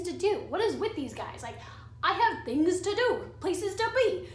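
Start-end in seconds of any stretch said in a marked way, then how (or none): 2.0-4.3 higher, louder exasperated protest; hammed-up exasperation. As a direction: protest as if too busy for this. Acting out exasperation at repeated calls.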